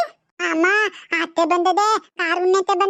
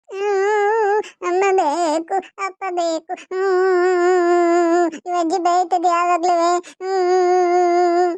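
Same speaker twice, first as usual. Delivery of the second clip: child's long droning mock sobs; acted crying; much slower than usual.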